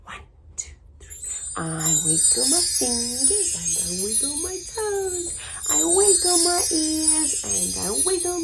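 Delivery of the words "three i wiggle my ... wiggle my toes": ordinary delivery, nothing marked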